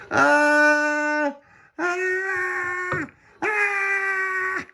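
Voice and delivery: long, very high wails; acted screams. A narrator's reading. Storm on the mast.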